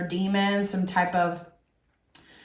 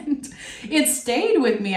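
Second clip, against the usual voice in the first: talking through a laugh, higher pitch; 4 semitones higher than usual. Real, not acted. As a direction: let giggles spill into the words. Laughing over a hurtful comment.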